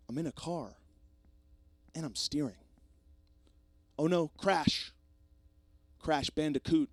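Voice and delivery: loud, high, hammed-up slam poetry recital; mock slam poetry. Showing off slam poetry to the listeners, hammed-up and loud. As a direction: perform it like a parody slam poet. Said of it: "You see me doing slam poetry".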